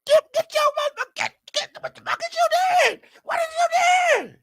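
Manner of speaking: high, yelled protest; shouted resisting arrest. Pretend. Pretending to fight off the arresting cops, shouting high.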